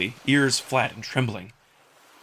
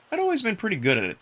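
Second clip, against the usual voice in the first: ordinary delivery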